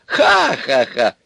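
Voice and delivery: slow, high, hoarse cackle; hoarse witch laugh. A narrator's reading. Baba Yaga laughs about supper, hoarse. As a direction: laugh huskily in three drawn bursts. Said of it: "засмеялась хрипло".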